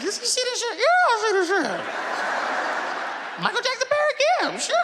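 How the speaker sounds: very high squealed disbelief; hammed-up high-pitched amazement; astonished. Guest and dog marvelling at the singing stars, astonished.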